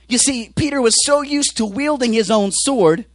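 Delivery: normal talking voice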